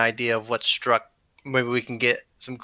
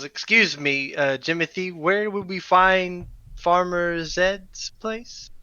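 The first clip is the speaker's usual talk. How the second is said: polite character question; 7 semitones higher, slower than usual. Acted. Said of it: "he leans back in and he goes".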